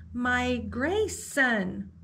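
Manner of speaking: drawn-out, slow and awed; slow wondering repeat. Acted. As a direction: savour the new word slowly. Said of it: "repeated Velma".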